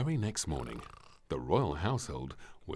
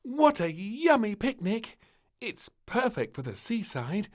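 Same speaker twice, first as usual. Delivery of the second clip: put-on high, bossy child voice; eager little princess; 7 dB louder than usual. A narrator's reading. Princess urging the tired family on, high and bossy. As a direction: insist cheerfully.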